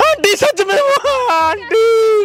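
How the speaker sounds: high tearful wail to auntie; put-on crying. Boy appealing to the girl's mother, mock crying. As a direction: plead in a hammed-up sob, pitched high. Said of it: "तू क्यों रो रहा है".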